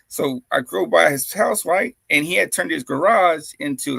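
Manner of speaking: ordinary delivery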